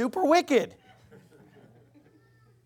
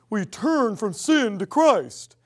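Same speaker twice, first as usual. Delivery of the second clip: slow mock Southern preacher; Southern false-gospel preacher impersonation; Southern accent; slower than usual. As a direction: stretch it out in a parody Southern drawl. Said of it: "do a Southern accent when I impersonate".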